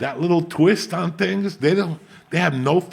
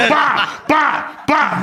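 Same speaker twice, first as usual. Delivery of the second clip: repeated high Pa yelps; retelling the climax with high yelps; mock climax yelps; 12 semitones higher, 4 dB louder, much slower than usual. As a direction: hammer out high yelps in a row. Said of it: "He kept going".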